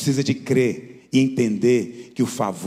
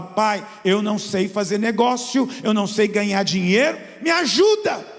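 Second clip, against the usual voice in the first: high, louder pleading plea to God; pleading prayer; 8 semitones higher, 3 dB louder than usual. Retold or imitated. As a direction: beg the Father for help, rising. Pastor quoting his prayer to God. (pedindo ajuda a Deus, em tom de súplica)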